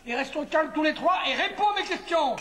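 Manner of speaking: high exasperated protest; exasperated outburst. Acted. Husband tells them to stop shouting, exasperated. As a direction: cry out in protest, then rein it in. Acted quarrel, demands an end to shouting.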